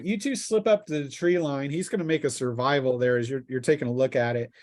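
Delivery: ordinary talk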